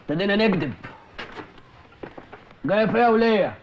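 loud, low, threatening; menacing interrogation. Acted. Interrogator grilling the woman suspect, loud and menacing. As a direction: lean on her hard.

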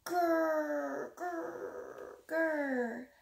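growled grrr from a scary beast; growling monster. A narrator's reading. The big scary thing growling at the hunter, monster growl.

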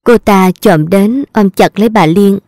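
usual speaking voice

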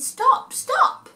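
very high stop stop; squeaky high stop; high cow cry. A narrator's reading. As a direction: call as the hungry cow. Cow voiced in story.

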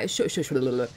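usual speaking voice

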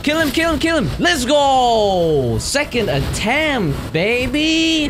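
high, excited victory yell; triumphant cheering. Real, not acted. Player beats boss on second attempt, cheering excitedly. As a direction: urge it on, then crow in victory.

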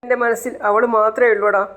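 breaking down over her; sobbing. Retold or imitated. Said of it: "പൊട്ടി പൊട്ടി കരയാണ്".

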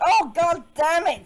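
loud, high cry of annoyance; high-pitched, loud frustration; exasperated outburst. Real, not acted. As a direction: burst out loudly in exasperation. Learns brother is on stream.

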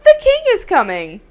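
high mock-urgent herald; servant's announcement. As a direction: burst in with urgent news, playfully. Servant runs up with news.

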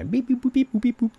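high-pitched robotic beep-boops; alien beeping. Pretend. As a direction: beep and boop like a little alien. Imitating a little alien guy.

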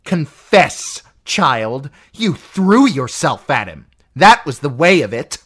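an aunt raging at her niece; furious aunt. Acted. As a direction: rage at the girl. Lysa raging at Sansa.

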